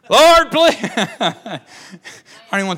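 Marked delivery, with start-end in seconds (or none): 0.1-0.8 hammed-up pleading prayer. Mocking silly prayer. 0.8-1.6 real laugh. Real, not acted. Laughs at own joke.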